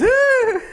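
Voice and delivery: very high squealing shriek with a laugh; imitated toddler squeal. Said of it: "and she goes".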